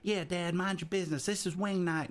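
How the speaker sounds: put-on high whiny Southern kid; bratty kid. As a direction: sass your dad like a teen. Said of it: "And he's".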